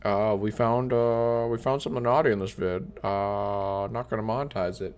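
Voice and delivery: soft, halting mock official; hesitant YouTube notice. YouTube telling a creator about demonetization, hesitant soft mumble. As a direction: hem and haw quietly through the bad news. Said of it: "I'll get an email from YouTube".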